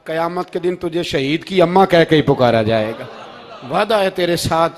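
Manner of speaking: loud, proclaiming sermon voice; impassioned preaching. Acted. Preacher voicing the Prophet's promise to a martyr's mother, loud proclamation.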